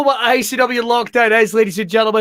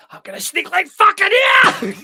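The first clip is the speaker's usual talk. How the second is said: high mock ninja yell, much louder; hammed-up ninja battle shout; 11 semitones higher, 3 dB louder than usual. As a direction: bellow it like a clumsy ninja. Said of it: "you've never seen a ninja movie going".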